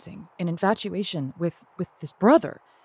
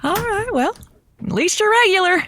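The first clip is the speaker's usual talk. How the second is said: dry tease; 12 semitones higher, 3 dB louder than usual. Acted. Host reacting to producer's bathroom break.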